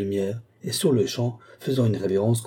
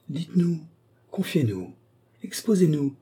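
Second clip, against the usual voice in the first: pressing, separated appeals, not shouted; clamouring cries; 8 semitones higher than usual. A narrator's reading. Devout women besieging the petitioner with questions, insistent. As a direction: badger her one voice after another. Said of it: "lui crie-t-on".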